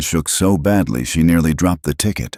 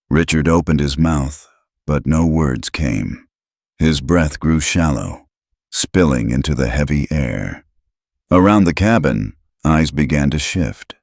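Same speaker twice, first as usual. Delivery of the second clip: ordinary delivery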